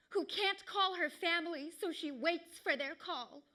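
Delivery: ordinary talk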